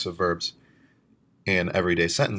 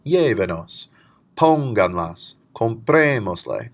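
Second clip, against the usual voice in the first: each word said louder, leaning on the accented vowel of Llévenos, Pónganlas, Comprémosle; stressed demo words; 3 semitones higher, 5 dB louder, slower than usual. Shown as a demonstration. Teacher drills the stressed syllables for students, louder. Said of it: "you can hear the word have a natural stress".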